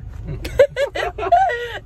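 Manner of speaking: a high, loud burst of laughter at herself. Real, not acted. Vlogger laughing at herself after saying she'll cry, high burst of laughter. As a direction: laugh out loud at how miserable she feels. Laughing at her own misery.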